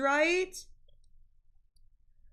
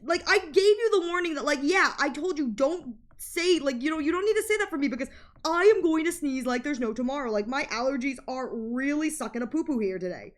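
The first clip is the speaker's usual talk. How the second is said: ordinary delivery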